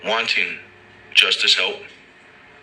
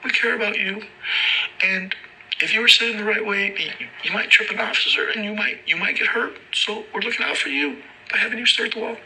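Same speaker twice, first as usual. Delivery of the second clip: much higher, falsetto cop voice; hammed-up high condescending officer; mock cop. Officers explaining the wall rule, high mock cop voice. Cops voiced in retold story.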